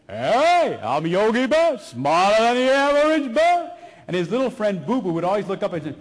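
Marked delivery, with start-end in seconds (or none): 0.1-3.7 loud, high, cocky cartoon voice; Yogi Bear. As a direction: belt it out with swagger like a cartoon bear. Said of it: "I'm watching the Yogi Bear cartoon show. And Yogi always had this great attitude".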